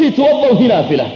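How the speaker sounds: توضأ وهي نافلة. stated flatly with a falling close; firm falling reply; firm answer. Retold or imitated. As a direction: settle it: توضأ وهي نافلة. said with a final fall. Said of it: "قلت".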